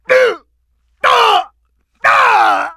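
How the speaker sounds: high, loud grunts of being hit; pained grunts. Acted. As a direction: yelp at each blow. Boss taking hits.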